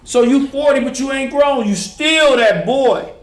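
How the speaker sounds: loud, high, impassioned; fired-up preaching. Real, not acted. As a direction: preach it at full force. Preaching about staying a boy.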